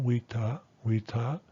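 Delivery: "wheat, ta" with pitch moving up, full "ah" in "ta"; upward tone. Shown as a demonstration. Teacher points out the pitch change, "wheat, ta" rising. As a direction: lift the tone on "wheat" and keep "ta" open. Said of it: "pitch change moving upward here".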